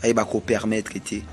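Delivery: ordinary talk, nothing marked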